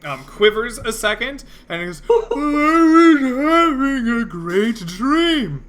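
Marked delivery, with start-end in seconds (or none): 2.1-5.6 sleepy voice. Acted. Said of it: "in a sleepy voice".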